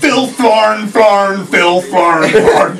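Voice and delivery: loud, slow, high echoing name call; next-time bit of a booming echo intro; echo announcement. Pretend. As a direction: boom the name out like an arena echo. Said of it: "I'll be like".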